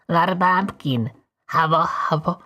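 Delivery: normal talking voice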